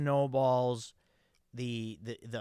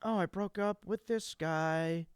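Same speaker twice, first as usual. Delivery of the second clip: mock pop singer; 6 semitones higher than usual. Mocking [name] lyrics.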